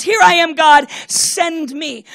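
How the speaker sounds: loud, high acted shout; volunteer at full voice, excited; speaking up eagerly. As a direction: call out eagerly, drawing out the last words. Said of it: "he speaks up and goes".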